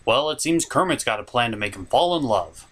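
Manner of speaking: normal talking voice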